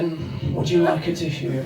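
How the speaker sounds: soft, hushed mock seduction; seductive. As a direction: purr it at her with a lick of the lips. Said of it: "seductively in the eyes, licked my lips".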